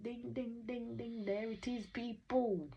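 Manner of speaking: high-pitched sing-out, ringing it like a bell; mock winning bell. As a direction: call it out high like a game-show bell. Mimics winning bell while commenting.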